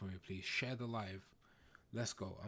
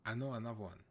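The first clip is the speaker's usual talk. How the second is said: ordinary talk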